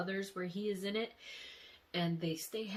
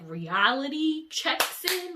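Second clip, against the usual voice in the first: slow, loud, higher proclamation; dramatic announcement; 8 semitones higher, 10 dB louder, slower than usual. Acted. Narrating her dream loudly and dramatically. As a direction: boom it out like a harsh wake-up call. Dramatic turn after waking.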